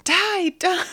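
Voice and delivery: high, loud screamed rage; screaming die. Retold or imitated. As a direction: scream it like a kid in full rage.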